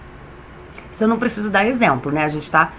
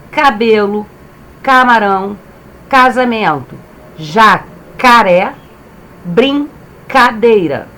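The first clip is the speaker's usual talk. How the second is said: slow drawn-out reading; 3 dB louder, slower than usual. Shown as a demonstration. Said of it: "teacher drawing out words for class".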